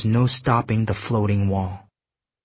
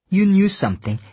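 sharply raised, insistent pitch; accusing; 9 semitones higher, 4 dB louder than usual. Acted. Kyuki keeps at Jujak for hiding something, accusing. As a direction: drive the accusation home, high.

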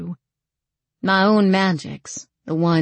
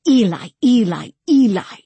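high, loud cry for Eli; mild scream, raised and insistent; screamed kit call; 5 semitones higher, 6 dB louder than usual. A narrator's reading. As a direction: cry the name out urgently. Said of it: "screamed kit call".

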